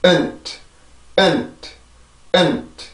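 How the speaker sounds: weak short 은트 ending in a released t; light 은트, t just a puff; pronunciation drill. Shown as a demonstration. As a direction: model unstressed 은트 by itself. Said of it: "발음을 먼저 해봅시다".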